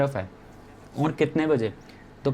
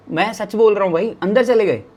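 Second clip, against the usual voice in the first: higher, louder disbelief; incredulous insistence; 6 semitones higher, 6 dB louder than usual. Real, not acted. Storyteller swearing the host went back inside, loud incredulous insistence. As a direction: insist on it with raised voice.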